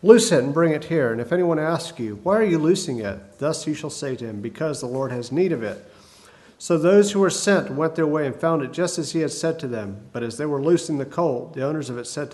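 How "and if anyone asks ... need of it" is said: usual speaking voice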